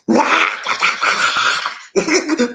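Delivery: long hammed-up wailing sob; mock sobbing. Man letting it out in the closet, mock sobbing. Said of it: "go and slip in the closet".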